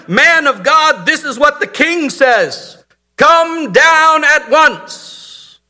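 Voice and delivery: high, slow, drawn-out insolent order; insolent command. Retold or imitated. Second captain ordering the prophet, insolent and slow. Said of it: "He's even more insolent than the first captain".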